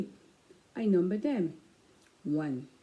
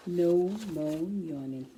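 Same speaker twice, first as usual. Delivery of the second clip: slow title read; slower than usual. Shown as a demonstration. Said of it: "title read again".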